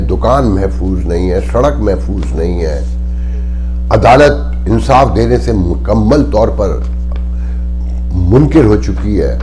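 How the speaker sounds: impassioned outcry. Real, not acted. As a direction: hammer every line like a protest speech. Impassioned tirade against the state. (پرجوش بلند آواز میں تقریر)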